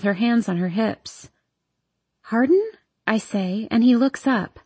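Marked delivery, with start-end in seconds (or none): 2.2-2.7 surprised call. A narrator's reading. Surprised to find him outside.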